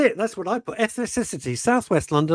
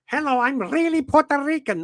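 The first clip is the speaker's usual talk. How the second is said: lightly voiced mock Puerto Rican accent, high and teasing; a hint of a put-on Puerto Rican accent, mocking; mocking the wife's put-on Puerto Rican accent; 10 semitones higher than usual. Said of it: "put on an accent like this".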